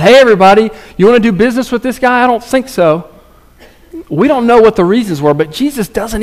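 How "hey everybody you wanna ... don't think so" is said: louder, higher mock public call-out; rally the crowd against the brother; hammed-up crowd appeal. Imagined man calling out crowd.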